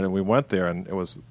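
normal talking voice

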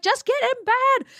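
put-on high, exasperated snap at the kids; mock fed-up parent at bedtime; impatient parent. Parent losing patience with tired kids at bedtime, exasperated snap. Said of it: "many a night I lose my patience and I'm like".

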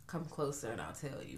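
hushed sinister clown voice; creepy low hush. Retold or imitated. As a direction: lure them in quietly. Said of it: "He was like".

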